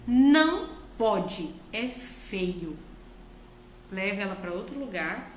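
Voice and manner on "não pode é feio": louder, slow, firm no; calmly but firmly stop the child; firm correction. Shown as a demonstration. Parent correcting a struggling child, slow firm no. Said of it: "chama a atenção dela".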